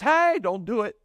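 acted startled warning; urgent warning. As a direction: call out to halt them. Jesus stops Mary clinging to him.

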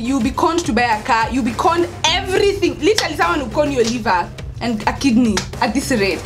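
ordinary delivery

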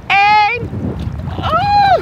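high, loud yelp going under; cold plunge yelp. Real, not acted. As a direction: shriek as she dives. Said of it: "ja, wennen". (gil bij het onderduiken)

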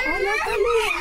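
squealing hello to the pets; excited greeting. Real, not acted. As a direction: greet the little gang with glee.

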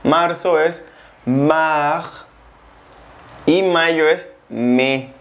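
slow, each word laid out; slow teaching pace. Shown as a demonstration. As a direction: spell it out slowly for learners. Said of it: "su pronunciación es diferente".

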